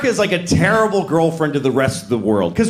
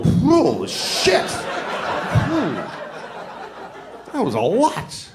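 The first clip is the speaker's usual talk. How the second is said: stunned reaction; 3 semitones higher, much slower than usual. Retold or imitated. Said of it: "that moment made me go".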